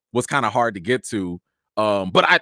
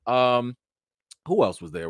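normal talking voice

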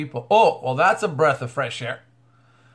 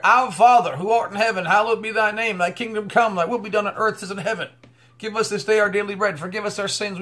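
higher, fervent recited prayer; prayer recital; 4 semitones higher than usual. Acted. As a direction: pray it out with fervour.

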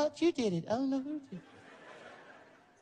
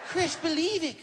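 [name] insisting; 7 semitones higher than usual. Retold or imitated. [name] telling starstruck Chris, high and emphatic. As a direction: push it up high and firm. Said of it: "Michael was getting mad".

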